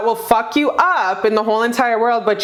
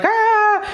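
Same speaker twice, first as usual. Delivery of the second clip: mock panic cry; 11 semitones higher than usual. Imitating someone panicking.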